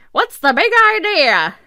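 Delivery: put-on high, loud, old-timey 1930s movie accent; fast-talking 1930s Mid-Atlantic accent. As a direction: ham up a 1930s newsreel accent, laughing through it. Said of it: "should I say it in a nineteen thirties accent?".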